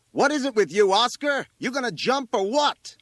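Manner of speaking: high, loud exasperated demand; impatient fireman's shout. A narrator's reading. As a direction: yell up at the kid on the roof.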